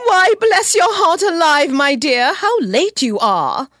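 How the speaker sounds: high exclamation settling as it goes; delighted greeting. Acted. Mother welcoming her late daughter home, delighted high exclamation.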